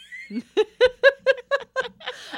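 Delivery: bursting into high laughter. Real, not acted. Date fell down stairs.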